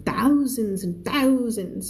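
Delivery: "Thousands and thousands" hammed up, each one swelling; added expressive emphasis. Shown as a demonstration. Teacher demonstrating flavourful reading, exaggerated swell on "thousands". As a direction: stretch and push both "thousands" for colour. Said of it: "เพิ่มเสียงขึ้นไป".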